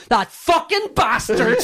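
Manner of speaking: loud, high, slow laughing curse; mock outrage. Seeing the stolen [name] illustrations, loud laughing outrage. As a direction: spit it out in comic fury.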